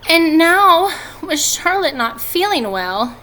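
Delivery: a touch of a fretting little pig; worried pig. Acted. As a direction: let Wilbur's worry rise, slowed down.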